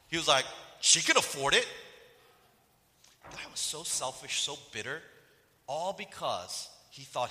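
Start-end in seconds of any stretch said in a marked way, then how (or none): 0.8-1.7 loud, high, defensive imitation; dismissive comeback, pushed high; entitled retort. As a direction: play the ungrateful son justifying it. Friend's entitled reply, acted.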